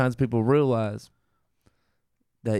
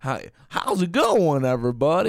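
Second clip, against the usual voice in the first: cowboy voice; 4 semitones higher than usual. Pretend. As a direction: greet them like a cowboy. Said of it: "Now I sound like a cowboy".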